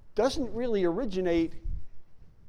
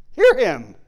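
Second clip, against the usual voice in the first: commanding voice; 3 semitones higher, 12 dB louder than usual. Acted. Quoting God's command from the cloud.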